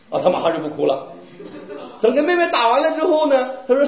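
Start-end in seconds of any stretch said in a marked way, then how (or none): none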